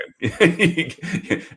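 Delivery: high, bright laugh breaking out; real burst of laughter. Real, not acted. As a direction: laugh it off, caught off guard. Overwhelmed by storytelling question.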